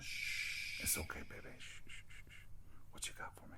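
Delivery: soft unvoiced whisper, soothing; soothing a scared dog. Real, not acted. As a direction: hush and calm a frightened pet. Interruption, soothing someone off-mic.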